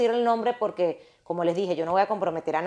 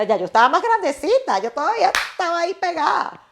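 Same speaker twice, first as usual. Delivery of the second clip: exasperated; 10 semitones higher, 5 dB louder than usual. Real, not acted. Exclaiming her own disbelief.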